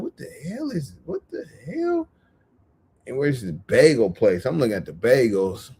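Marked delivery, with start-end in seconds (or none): 0.0-2.1 much higher squeaky disbelief; shocked what the hell. Retold or imitated. Seeing kids in Christmas pajamas, a high squeaky shocked reaction.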